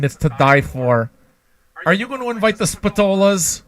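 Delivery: high-pitched gushing New Jersey party hostess; mock a New Jersey Italian fussing over guests. Planning who to invite to the garden party, mock New Jersey Italian. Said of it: "fucking New Jersey fake Italians".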